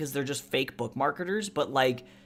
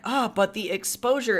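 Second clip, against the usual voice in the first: high, mocking artist voice; a high mocking take on an artist's whine; 7 semitones higher, 3 dB louder than usual. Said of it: "one actual artist being like".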